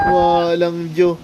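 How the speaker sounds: usual speaking voice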